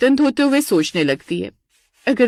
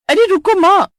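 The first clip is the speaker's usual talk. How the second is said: much higher, loud plea; calling out; 10 semitones higher, 5 dB louder than usual. Acted. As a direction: shout to stop her hanging up. She tries to stop mother hanging.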